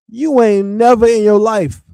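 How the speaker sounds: normal talking voice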